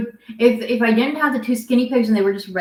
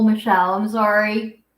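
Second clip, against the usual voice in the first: ordinary delivery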